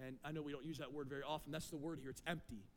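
normal talking voice

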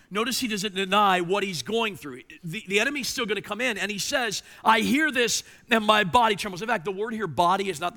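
high, loud sermon build; fervent preaching. Acted. Pastor voicing Habakkuk's trembling, loud fervent preaching. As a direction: lift the voice as his fear mounts.

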